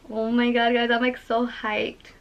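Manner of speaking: usual speaking voice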